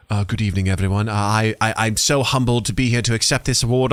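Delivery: humble British award-winner voice; British accent. Retold or imitated. British actor at an award show, humbled British accent. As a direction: mimic a British actor's polite acceptance speech. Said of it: "when a great British actor does a killer".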